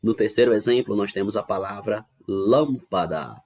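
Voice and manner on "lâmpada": "lâmpada" slow, with a strong first "lâm"; stress on LÂM. Shown as a demonstration. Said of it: "a sílaba mais forte dela é o lam". ("lâmpada" devagar, com força no "lâm")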